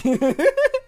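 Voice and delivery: very high, loud burst of laughter; shrieking laugh. Real, not acted. Streamer cracking up at a bizarre manga line, shrieking laugh. Absurd translation, slime and mermaid.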